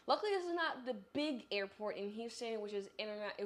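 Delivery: ordinary delivery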